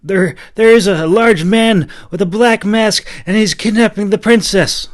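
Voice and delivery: high loud breathless report; panicked boy voice; frantic boy. A narrator's reading. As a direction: blurt out the danger.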